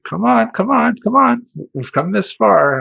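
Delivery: put-on high, loud coaxing girl voice; coaxing girlfriend. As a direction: urge him on in a bright girly voice. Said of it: "the girl I'm with is like".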